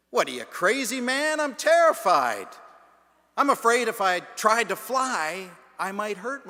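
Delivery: loud, high, panicky protest; the crow protests to Fred, loud and high with alarm. Retold or imitated. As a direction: squawk out your fear like a startled bird.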